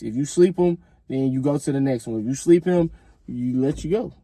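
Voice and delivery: ordinary talk, nothing marked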